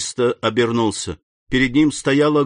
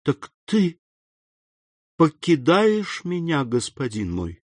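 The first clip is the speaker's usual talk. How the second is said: muffled by tears; 5 semitones higher, slower than usual. A narrator's reading. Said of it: "спросила голосом глухим от слёз".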